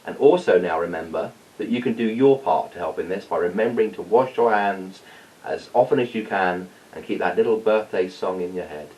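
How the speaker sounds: ordinary delivery, nothing marked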